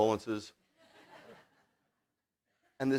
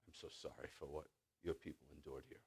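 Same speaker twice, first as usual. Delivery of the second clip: very soft, low imitation of remorse; retold tearful apology; 7 semitones lower, 21 dB quieter than usual. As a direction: quietly act out a grieving man's apology. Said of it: "and he's crying".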